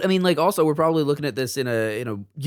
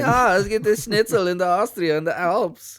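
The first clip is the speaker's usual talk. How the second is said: put-on Austrian accent; 6 semitones higher than usual. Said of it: "Russian accents for Austrian".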